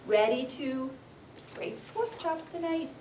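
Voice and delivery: normal talking voice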